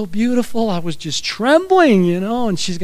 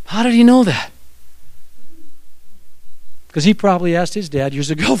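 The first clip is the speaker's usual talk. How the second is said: ordinary delivery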